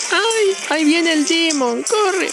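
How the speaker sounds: tiny frightened creature squealing a warning; little Grunt squeaking in mock panic; squeaky little alien. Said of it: "Esas madres que decían el".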